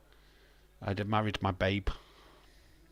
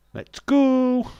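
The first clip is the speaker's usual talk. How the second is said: high, loud hype yell; cheering shout; 13 semitones higher, 10 dB louder than usual. Real, not acted. Streamer cheering the list game, high loud shout. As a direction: yell it out like a celebration.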